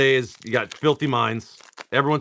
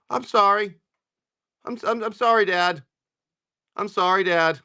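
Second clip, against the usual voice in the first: higher, sheepish cartoon-kid voice; Disney character apology; 6 semitones higher than usual. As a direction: whimper it like a guilty cartoon kid. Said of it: "He looks like a Disney character".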